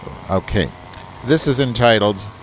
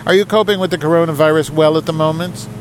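higher, formal letter-reading voice; email read aloud; 9 semitones higher, 5 dB louder than usual. Acted. Host reading Roger's email aloud to the audience, higher and formal. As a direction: put on a proper, correspondence tone.

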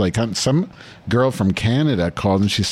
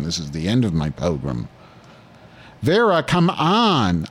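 ordinary talk

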